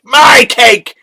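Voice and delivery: hammed-up wail over the cake; playful shriek of loss; mock outrage. Mock dismay over poison cake.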